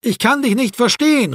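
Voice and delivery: high-pitched call through glass. A narrator's reading. Erwin telling Marco he can't hear him, calling out. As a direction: make yourself heard through the window. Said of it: "rief Erwin".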